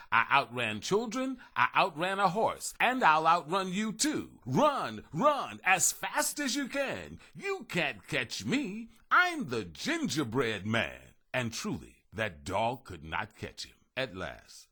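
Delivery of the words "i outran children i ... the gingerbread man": high, loud, slow singsong boast; shouted taunt. A narrator's reading. Gingerbread man taunting the dog, high slow singsong. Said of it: "He shouted".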